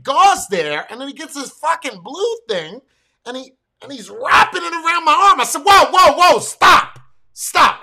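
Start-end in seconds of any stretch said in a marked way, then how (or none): 5.6-7.8 loud, high shout to halt someone; alarmed shouted objection. Retold or imitated. Patient stops the man wrapping his arm, alarmed shouting. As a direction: cut in forcefully, much louder and higher.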